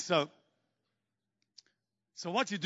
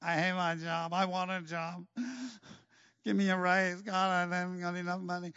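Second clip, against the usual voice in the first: mock self-pitying drone; softer imitated whine of complaint; moaning and whining; 3 semitones lower, 6 dB quieter than usual. People griping to God about job and pay, mock moaning. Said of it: "people moaning and whining about everything".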